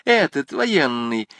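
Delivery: rushing, high and eager; impetuous. A narrator's reading. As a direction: blurt it out in one decisive rush. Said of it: "Со стремительностью воды". (стремительно и решительно)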